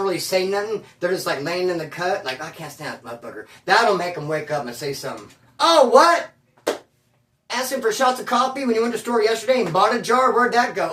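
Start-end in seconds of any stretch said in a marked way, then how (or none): 2.4-3.5 quick, much softer grumble under the breath; resentful mutter. Retold or imitated. Resentful inmate muttering his grudge. 5.6-10.9 higher, louder accusing outburst; mock call-out. Inmate loudly calling him out.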